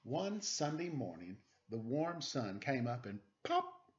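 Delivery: slow, gentle picture-book read-aloud; reading to children. Acted. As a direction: read it like a bedtime story for little kids.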